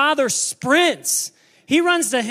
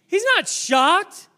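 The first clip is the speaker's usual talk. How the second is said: high, loud, insistent; preaching yell; 6 semitones higher, 4 dB louder, slower than usual. Real, not acted. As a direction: hammer it home at the top of your voice. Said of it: "I'm sorry I'm yelling".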